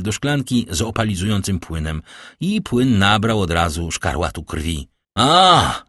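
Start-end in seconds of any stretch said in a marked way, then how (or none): 5.2-5.8 loud, high exclamation; cry of discovery. A narrator's reading. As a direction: exclaim as the truth strikes you. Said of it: "zawołał Darwini". (głośny okrzyk odkrycia)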